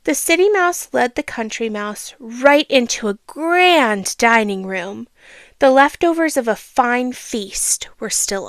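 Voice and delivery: storybook reading voice; a child reading a fable aloud; story narration. A narrator's reading. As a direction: read it like a bedtime story.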